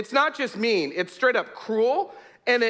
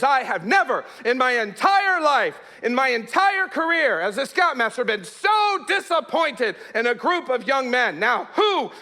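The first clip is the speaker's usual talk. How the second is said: high, slow, emphatic telling-off; hammed-up outraged shout, word by word; imitated angry yelling; 5 semitones higher than usual. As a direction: act out a scoutmaster dressing down the troop. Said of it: "he yells at us".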